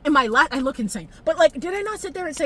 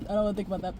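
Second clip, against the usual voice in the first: softer, lower, trailing off; fading to a murmur; 4 semitones lower, 9 dB quieter, much faster than usual. Real, not acted. Vlogger avoiding the sad thought, fading murmur. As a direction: let it die away in distress. Third repeat, almost gone.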